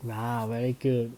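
ordinary talk

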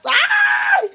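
loud, very high "ay" yelp; hammed-up shriek of sudden pain; mock pained yelp. Woman re-enacts a sudden painful mishap, mock yelp.

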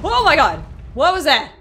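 high, loud burst of alarm; startled yell. Real, not acted. Player reacting to a sudden blast in game, startled yell. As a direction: cry out, jolted and loud.

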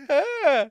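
high, feigned appreciative "ah"; put-on indulgent cooing noise; polite forced reaction. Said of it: "you have to go like".

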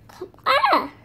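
high, loud yelp on effort; straining effort. Pretend. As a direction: yelp while tugging something free. Pulling at stuck feet.

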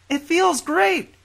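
high, slow, called from behind a door; muffled through a door. Acted. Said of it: "she called in a muffled voice through the door".

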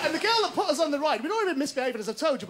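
usual speaking voice

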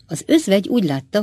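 plain reading voice

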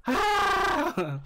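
very high yelping cry; Chewbacca yelp. Pretend. Streamer tries the wookiee noise again, high yelp. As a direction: squeal it like a wookiee. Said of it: "I suck at that Chewbacca noise".

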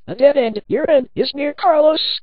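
loud, rising menace; menacing threat. A narrator's reading. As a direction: threaten a cornered victim, pitch climbing. Killer corners and threatens victim.

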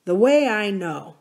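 ordinary delivery, nothing marked

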